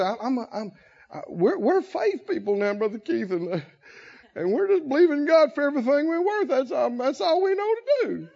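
mock whining believer; halting and soft, then a rising, hurried whine; pitiful whine. Confused faith people pleading to Brother Keith, pitiful whine. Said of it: "Faith don't whine like that".

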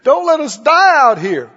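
pleading, starting high on the first please; mock begging. Disciples pleading with God in the storm, mock begging. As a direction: imitate people begging for their lives. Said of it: "they're all begging".

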